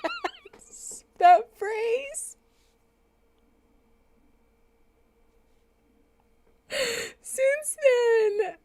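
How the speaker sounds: high, laughing so hard it turns to crying; squeaking through tears of laughter. Real, not acted. To the chat, remembering Aaron and the old phrase, laughing to tears.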